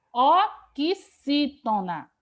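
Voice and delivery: "oxítona" broken into slow, firm syllables. Shown as a demonstration. Teacher telling class how to classify sofá, syllable by syllable. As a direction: hammer out each syllable of "oxítona". Teacher spells out the category name.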